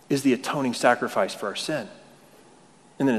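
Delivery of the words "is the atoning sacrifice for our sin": usual speaking voice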